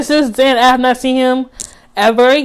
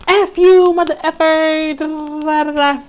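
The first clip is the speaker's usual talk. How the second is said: mock cussing out; 5 semitones higher than usual. Retelling to viewers how she cussed him out, angry. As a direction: fire off the insult, then let it fade.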